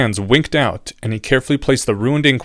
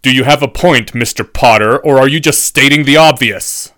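loud, biting impatience; irritated professor; 3 semitones higher, 7 dB louder than usual. A narrator's reading. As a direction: demand the point with loud irritation. Irritated professor cuts student short.

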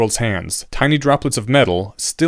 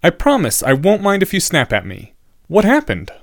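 higher-pitched student character, concerned; earnest young student; 7 semitones higher than usual. A narrator's reading. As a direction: raise the voice into an earnest pupil's.

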